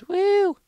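very high, loud cheer; excited whoop. Real, not acted. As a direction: whoop for the win. Reaching fourth place.

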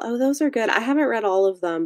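normal talking voice

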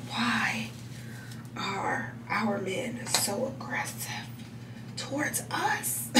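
slow, whispered disbelief; hushed lament. Retold or imitated. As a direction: breathe it out like a weary secret.

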